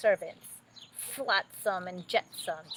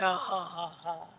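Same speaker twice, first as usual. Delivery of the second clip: wicked witch's ha-ha-ha cackle; evil cackle. Acted. Sea witch cackling as she plots, evil cackle.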